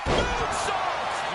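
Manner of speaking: high, loud move call; excited call. Real, not acted. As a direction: yell the move name. Commentator calling a big move.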